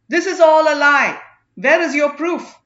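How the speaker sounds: outraged priest shouting down his accuser; angry denial. Acted. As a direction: fling the accusation back in his face. Accused priest denies the charges.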